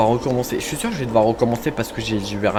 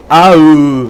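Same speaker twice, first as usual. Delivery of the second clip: sudden loud, higher yelp; real jolt of fright; startled cry; 5 semitones higher, 15 dB louder than usual. Real, not acted. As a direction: cry out in surprise, loudly. Startled by something in game.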